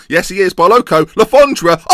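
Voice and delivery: loud, high goal shout; excited shout as his striker scores; excited goal call. Real, not acted. Goal commentary.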